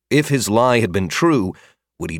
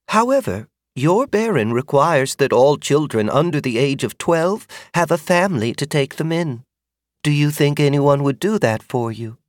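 higher, probing motherly voice; kindly older woman; 6 semitones higher than usual. Acted. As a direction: ask delicately. Magda asks about family.

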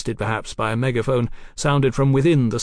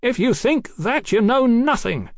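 scornful retort; 12 semitones higher, 3 dB louder than usual. Acted. Captain dismisses the doctor's claim, scornful. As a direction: throw back a contemptuous retort. Enemy retorts in shouted argument.

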